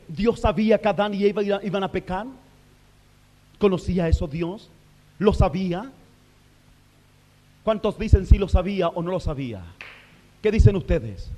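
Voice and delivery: ordinary talk, nothing marked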